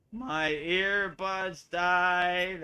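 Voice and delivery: very slow, halting robot voice. Retold or imitated. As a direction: drag each word out like a glitching robot. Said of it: "slow moo robot".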